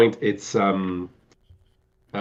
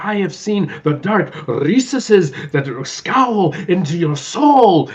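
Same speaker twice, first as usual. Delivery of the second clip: higher, slow, booming stage declamation; mock-Shakespearean speech to the cop; grand theatrical Gomez; 9 semitones higher, 4 dB louder, much slower than usual. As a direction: savor every word like a tragedian. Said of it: "and he's like".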